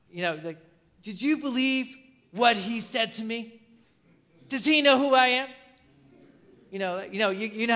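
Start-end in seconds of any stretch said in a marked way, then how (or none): 1.0-5.5 mock-offended, pitched up; imitating someone affronted; indignant pride. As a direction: act out hurt pride in mild indignation. Voices wounded pride, indignant.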